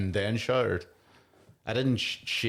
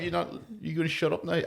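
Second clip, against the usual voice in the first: hammed-up rude stranger, higher and pushy; mock hostile brush-off; 7 semitones higher than usual. Retelling a train joke to a friend, mock hostile and higher. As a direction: act out how the quip sounded to her: get lost. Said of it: "it just made me sound like".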